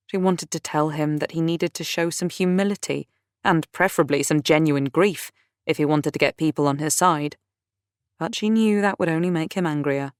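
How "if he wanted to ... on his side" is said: ordinary delivery, nothing marked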